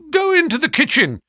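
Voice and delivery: high, loud order. A narrator's reading. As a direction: call it out for all to hear. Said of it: "he continued out loud".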